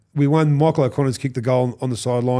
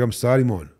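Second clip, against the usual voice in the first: ordinary talk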